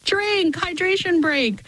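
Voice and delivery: playful imitation of a bar chant; cheering bar crowd. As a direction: toss it out like a happy drinker at a bar. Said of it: "everyone was like".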